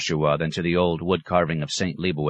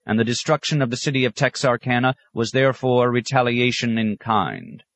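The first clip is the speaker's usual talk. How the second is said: raised, official newsreader pitch; radio announcer; 7 semitones higher, 3 dB louder than usual. A narrator's reading. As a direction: deliver a wartime news bulletin over the radio.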